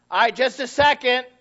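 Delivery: high, loud put-off; mock kid stalling. Child stalls the parent, high and loud.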